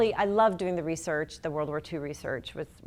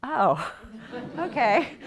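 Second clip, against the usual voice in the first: startled little laugh, then a high, loud okay; surprised and amused at once; laughing surprise; 6 semitones higher, 4 dB louder, much slower than usual. Retold or imitated. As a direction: laugh through the realization. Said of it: "I'm like".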